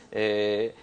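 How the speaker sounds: ordinary delivery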